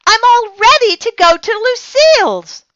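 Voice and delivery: put-on very high, loud, fast excited child; squealing eager little girl; eager calling. A narrator's reading. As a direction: call out bursting with eagerness. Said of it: "I said".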